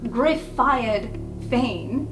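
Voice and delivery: usual speaking voice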